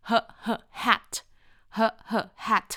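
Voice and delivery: "hat" built up from two short "huh" sounds; separated "huh, huh, hat"; phonics sounding-out. Shown as a demonstration. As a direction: puff the h twice, then say "hat". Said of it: "แต่ออกเสียงว่า".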